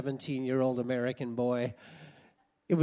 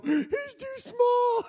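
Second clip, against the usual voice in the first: much higher, slow, half-laughing wail; young self's dismay; 6 dB louder, much slower than usual. Retold or imitated. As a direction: wail it in mock despair. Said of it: "and I'd go".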